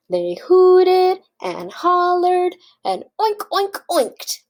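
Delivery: high, loud, drawn-out chant; excited oinking. A narrator's reading. Reader leading children as pigs, excited oinking. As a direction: lead a hooting, oinking chant. Children invited to oink along.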